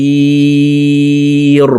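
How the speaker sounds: الخير with the ya drawn out one alif and the ra stopped; slow chanted الخير; long yaa lin. Shown as a demonstration. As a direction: stretch الخير one alif then stop on ra. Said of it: "তো দেখুন এইভাবে পড়ব।".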